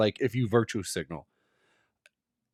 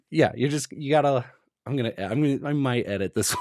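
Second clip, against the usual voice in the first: normal talking voice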